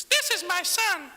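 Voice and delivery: comic falsetto voice from the cloud; silly high voice. Pretend. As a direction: make God sound absurdly high and drawn out. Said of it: "it'd be weird if it was".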